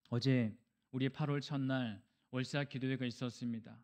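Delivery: normal talking voice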